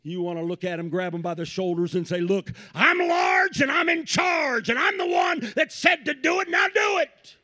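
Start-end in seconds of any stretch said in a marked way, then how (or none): 2.2-7.4 high loud parental shout; frustrated parent yell. Retold or imitated. Parent voiced yelling at kid.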